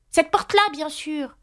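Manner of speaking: sharp, high, exasperated cry; irritated. A narrator's reading. Alice loses patience with the frog, high and irritated. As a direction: snap it out impatiently. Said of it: "Alice, tout irritée".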